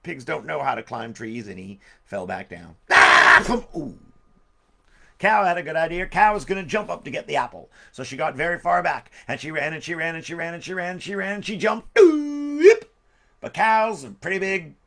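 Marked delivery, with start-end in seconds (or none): 2.9-4.0 loud cartoon fall noises; yelp, then a thump, then a groan; falling yell and thump. Pig falls from tree. 12.0-12.9 loud, high cartoon yelp; high jump yelp. Cow jumps.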